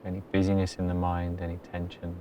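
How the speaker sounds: very soft, low-key meditation voice with long pauses; hushed, even delivery, letting each phrase settle; guided meditation. Acted. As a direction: guide them gently into stillness. Leads a meditation.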